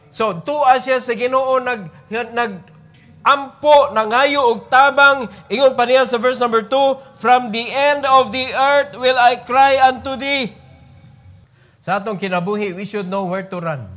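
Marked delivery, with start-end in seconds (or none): 0.1-10.6 louder, higher sermon build; preaching at full force. Acted. Preacher urging the congregation, loud preaching. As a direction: drive the point home to the church.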